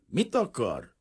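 curt question, pitched a little up; unfriendly. A narrator's reading. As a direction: ask it without any welcome. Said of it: "kérdezte nem éppen barátságosan". (nem éppen barátságosan)

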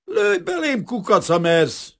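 very high, loud taunt slowing at the end; furious character challenging a gunman; snarling dare. A narrator's reading. Kazimír facing the revolver, snarling defiantly. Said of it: "vicsorgott rá Kazimir a revolverre".

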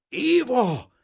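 very high, loud desperate call; panicked shout through an empty house; terrified cry. A narrator's reading. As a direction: scream the name in dread. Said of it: "kiáltotta valami rettenetes belső".

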